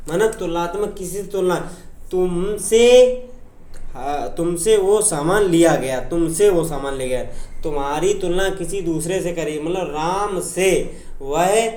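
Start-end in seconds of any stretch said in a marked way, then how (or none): none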